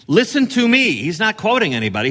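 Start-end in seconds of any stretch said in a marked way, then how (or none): 0.1-0.9 higher and louder, a summons; commanding call. Shown as a demonstration. Preacher voicing God's summons to the coastlands, loud. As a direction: call it out to be heard far off. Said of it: "it's a speaker saying".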